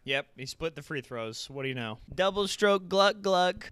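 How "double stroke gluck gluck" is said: mock announcer call.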